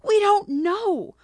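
shrieking. Acted. Agitated monkey shrieks at the sisters. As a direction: screech it out in agitation. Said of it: "the Cheshire monkey shrieked".

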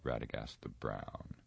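low, soft derision; derisive laugh. A narrator's reading. Saruman derides Radagast to Gandalf, mildly mocking. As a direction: drop the voice in understated mockery. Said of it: "laughed Saruman".

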